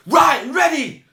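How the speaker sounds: high, loud, pumped-up yell; acted-out eager shout; worked-up shout. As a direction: bark it like you're raring to record. Retold Storm returning to booth.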